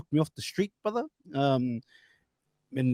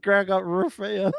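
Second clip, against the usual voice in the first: ordinary delivery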